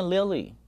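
Lily with "uh" in the first syllable; Lily gliding through a schwa; correct pronunciation. Shown as a demonstration. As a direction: say Lily as li-uh-lee. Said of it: "它念Lily".

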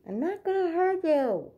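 high, loud reassurance to a scared dog; high coaxing voice. Real, not acted. Coaxing scared dog, then repeated.

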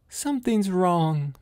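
high, slow, anxious moan. A narrator's reading. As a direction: moan it out with a mother's worry. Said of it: "Missus Walton moaned".

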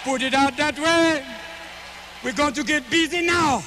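slow, laid-back Jamaican storytelling; Jamaican accent. Acted. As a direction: stretch the words out in a Jamaican lilt.

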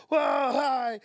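loud, high-pitched starstruck yelp; star-struck greeting. Retold or imitated. Comic introduced to his hero, star-struck.

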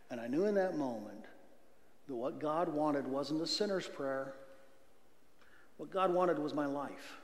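ordinary talk, nothing marked

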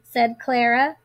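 plain reading voice

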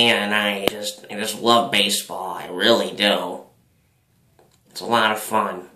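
put-on slurred, loose drunk speech. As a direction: talk like a tipsy old baseball fan. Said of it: "here's my drunk voice".

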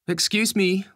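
high, projected, polite interruption; loud call for attention. A narrator's reading. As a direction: pipe up loudly to get the driver's attention. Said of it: "Lisa says loudly".

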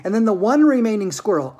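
plain reading voice

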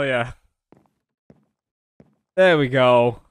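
usual speaking voice

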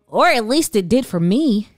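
ordinary delivery, nothing marked

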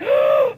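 high, sudden shocked gasp; a quick, high intake of breath; sharp gasp. Retold or imitated. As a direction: gasp in alarm at the danger. Emma sees the wild pig.

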